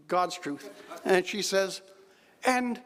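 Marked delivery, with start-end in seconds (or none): 2.4-2.8 a lightly put-on regal voice, higher pitch; a polite royal question, gently mimicked; the Queen's voice. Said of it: "I can't do the accent".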